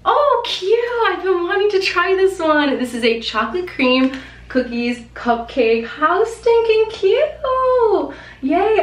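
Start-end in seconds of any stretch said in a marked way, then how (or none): 0.0-2.8 high, loud gushing delight; excited squeal. Real, not acted. Squealing over a cute recipe. 5.9-8.8 slow, high, loud gush; excited squeal. Real, not acted. Squealing over a cute recipe.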